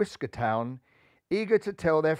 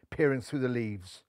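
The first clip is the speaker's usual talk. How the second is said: ordinary delivery, nothing marked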